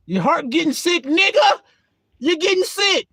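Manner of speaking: high, loud urgent warning; loud heckling. Acted. Preacher warning a man whose heart gets sick, loud heckling. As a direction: call it out high and insistent. Heckles the doubting inner voice, loudly.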